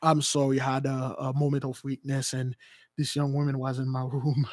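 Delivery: low, soft, halting apology; mock confession. Caught man apologizing to people, sheepish mock confession. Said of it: "those people will come up and said".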